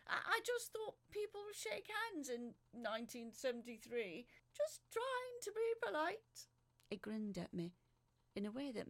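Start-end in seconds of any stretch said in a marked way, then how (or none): 0.1-6.5 lightly voiced polite man; a hint of [name]. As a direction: play it politely innocent. [name]'s voice continues.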